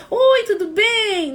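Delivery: very high, loud, slow "Oi, tudo bem?"; exaggerated ritual greeting. Mocking people who greet mourners with a hollow, very high "Oi, tudo bem?". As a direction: sing out a fake cheery hello.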